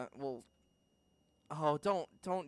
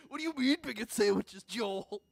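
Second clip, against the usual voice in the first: higher, louder mocking villain voice; mock Lord Edgy; 7 semitones higher, 5 dB louder than usual. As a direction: ham up an outraged space lord. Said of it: "Lord Edgy the Edgy is fucking talking".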